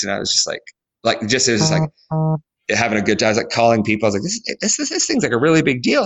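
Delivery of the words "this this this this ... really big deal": much higher, giddy and breathless; a wide-eyed, giddy rush; excited. Retold or imitated. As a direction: squeal it to a friend on the phone. Calling people after winning.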